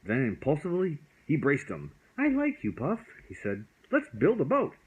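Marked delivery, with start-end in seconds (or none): 2.2-3.0 put-on much higher, bright child voice; a boy gushing with affection; eager little boy. Child hugging dragon. 3.9-4.7 high, loud, eager; excited boy. Acted. Excited child.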